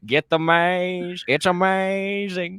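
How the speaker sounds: drawn-out goofy high voice; slow mock pun. Host milking a pun on maze, slow and goofy. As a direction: stretch the pun for laughs.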